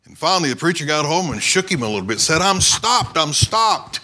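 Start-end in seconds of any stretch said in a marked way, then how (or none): 2.4-4.0 high, insistent retort, retold; imitated exasperated outburst. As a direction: act out demanding answers from a frantic man. Exasperated preacher shakes the screaming man.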